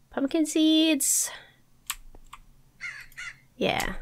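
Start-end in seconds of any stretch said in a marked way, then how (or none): 0.1-1.3 "Pumpkin seeds" drawn out slowly and higher, falling on "seeds"; sing-song higher. Real, not acted. To herself while sorting inventory, "Pumpkin seeds" high and slow.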